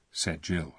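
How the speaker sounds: usual speaking voice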